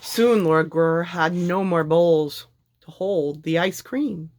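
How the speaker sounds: ordinary delivery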